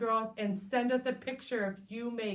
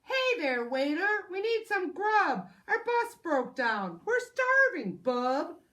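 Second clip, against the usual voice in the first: higher, louder gruff raccoon demand; loud raccoon holler; hungry raccoon voice; 6 semitones higher, 6 dB louder than usual. Acted. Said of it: "The raccoons hollered".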